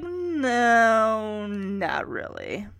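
long drawn-out, sinking no; mock-polite slow decline; reluctant refusal. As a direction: turn it down slowly and reluctantly. Imagined reluctant refusal to a bore.

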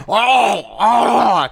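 high, loud oh oh gasps; mock gasping shout. Friend imitating someone bobbing for apples, loud gasps. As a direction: gasp like dunking for an apple.

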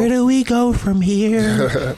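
dramatic. Pretend. Dramatic question.

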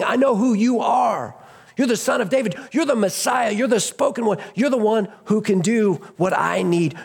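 high, urgent declaration; insistent cry. Acted. Beggar declares to Jesus who he is, crying out insistently. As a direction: proclaim it high, phrase after phrase.

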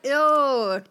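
Caribbean lilt on ew; a quick Caribbean-accented ew. Retold or imitated. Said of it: "That was me doing a Caribbean accent".

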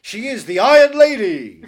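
very loud, booming title reveal; mock stage announcer. Host hails Meryl Streep on stage, booming mock announcer. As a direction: proclaim it with a laugh in the voice.

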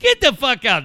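loud, high mock outburst; exclaimed disbelief. Dad imagining an onlooker's reaction, loud mock outburst.

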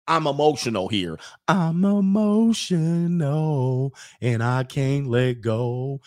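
slow, hammed-up whimper; mock emotional. Imitating people arguing with feelings, mock whimper. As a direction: parody an overwrought plea. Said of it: "they'll end up saying something like".

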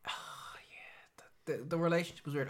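fast, hushed whisper. Real, not acted. Co-host whispers back agreement, fast. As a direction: keep the whisper going, quickly.

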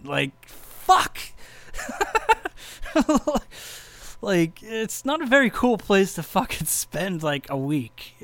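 high, loud, slowed laughing groan; laughing exasperation. Real, not acted. Sick on tour in Dayton, laughing exasperation. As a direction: vent the frustration through a laugh.

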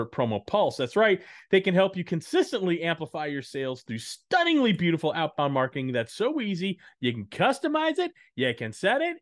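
loud, high, hyped-up pitchman; carnival barker voice. Acted. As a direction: bark it like a fairground huckster. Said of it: "some weird Carnival Barker voice there".

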